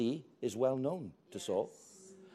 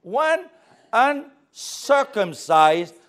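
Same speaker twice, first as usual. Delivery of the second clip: indignant; 5 semitones higher, 11 dB louder, slower than usual. Acted. David indignant at the brothers.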